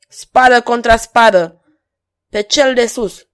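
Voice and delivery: loud, high battle call; challenge. A narrator's reading. Stranger challenging the courtiers to swords, defiant call. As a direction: call them out boldly.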